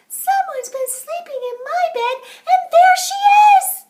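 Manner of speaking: put-on very high, loud squeal of a baby bear; baby bear shouting. A narrator's reading. Baby bear finds Goldilocks in his bed, high shout. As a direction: shriek it like an excited little cub. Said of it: "the baby bear said".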